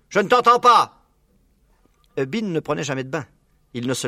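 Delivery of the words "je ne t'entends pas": high, loud complaint; hard-of-hearing shout. A narrator's reading. Deaf Bean to Buns, loud complaint. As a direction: call out as if half deaf.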